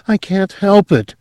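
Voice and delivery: a high, anguished outburst; cried out. A narrator's reading. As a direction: cry it out, high and desperate. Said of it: "she cried".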